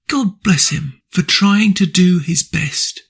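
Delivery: usual speaking voice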